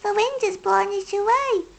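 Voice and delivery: high, mildly sad hedgehog voice; a touch of sorrow, high and small; sad little hedgehog. A narrator's reading. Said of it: "said Little Hedgehog sadly".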